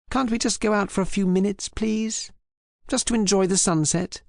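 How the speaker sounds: lightly voiced woman coaxing the attendant; a hint of a charming lady asking a favour; sweet pleading wife.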